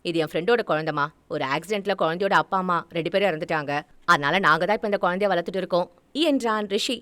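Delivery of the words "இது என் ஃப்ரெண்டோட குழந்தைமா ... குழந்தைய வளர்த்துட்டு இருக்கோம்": low, fast male character voice; Rishi, the son. Acted. Son lying to mother about the baby, low and hurried. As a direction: explain quickly in a lowered voice.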